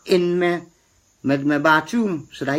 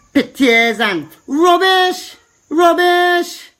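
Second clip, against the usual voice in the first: indignant; 10 semitones higher, 4 dB louder, much slower than usual. Real, not acted. Ranting at Facebook posters.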